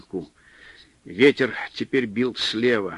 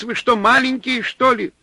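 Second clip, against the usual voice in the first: yelling; 12 semitones higher, 4 dB louder than usual. A narrator's reading. Supply man still yelling.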